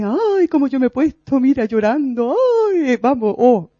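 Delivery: dramatic self-pity. Retold or imitated. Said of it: "era dramática total".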